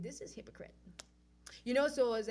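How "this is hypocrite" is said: whispered aside. Retold or imitated. Telling herself the festival is wrong, whispered. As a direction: breathe it as a private aside.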